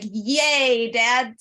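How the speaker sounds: mock cheer. Daughter mocking the joy she should have felt, mock cheer. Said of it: "instead of like".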